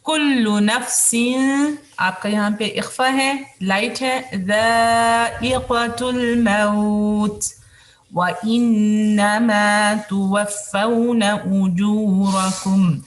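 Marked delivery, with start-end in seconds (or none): 0.0-1.8 slow melodic tajweed recitation; Quran recitation. Shown as a demonstration. 4.5-13.0 slow melodic tajweed recitation; Quran recitation. Shown as a demonstration.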